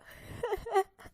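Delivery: high burst of laughter; loud laugh. Acted. Storyteller laughing at the underwear, loud laugh. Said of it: "इतनी तेज हंसता हुआ".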